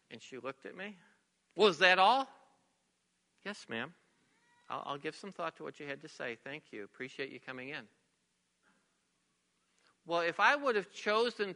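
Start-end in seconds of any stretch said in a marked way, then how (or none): 1.6-2.3 louder, mock indignant challenge; indignant retort. Angry mother's retort, retold. 3.4-7.9 low, hushed, deferential calm; very soft voice. Retold or imitated. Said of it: "in a very soft voice".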